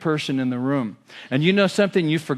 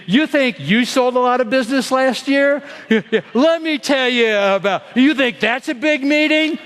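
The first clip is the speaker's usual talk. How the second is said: higher and louder, scoffing braggart; mock one-upper; 8 semitones higher, 3 dB louder than usual. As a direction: play the boastful one-upper, laughing at them. Mocking one-upping salespeople.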